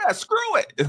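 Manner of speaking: high mock heckle; sound board clip. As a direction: drop it like a sound effect. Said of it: "I want it on a board where I go".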